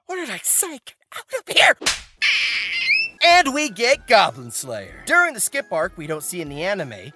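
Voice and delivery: usual speaking voice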